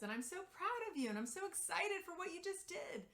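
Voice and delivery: a hint of the niece's cheery voice; aunt playfully echoing toddler praise to her son.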